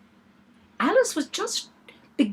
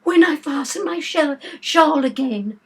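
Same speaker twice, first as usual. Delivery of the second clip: groaning; 7 semitones higher, 7 dB louder than usual. Acted. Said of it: "the poor Queen groaned out".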